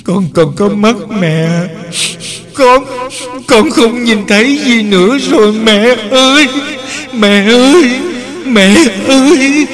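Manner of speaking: put-on high whimpering child voice. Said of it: "cô bé lại rên rỉ".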